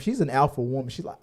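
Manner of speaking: ordinary talk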